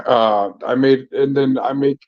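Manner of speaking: ordinary talk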